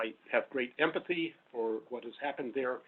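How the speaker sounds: ordinary talk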